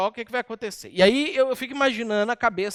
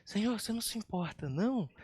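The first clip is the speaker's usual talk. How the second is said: soft, fast, timid little question; a meek Marta, softly asking Jesus; meek polite ask; 9 dB quieter, faster than usual. Shown as a demonstration. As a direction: ask gently, almost apologising. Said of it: "Eu não acho que ela chegou pra Jesus e falou assim".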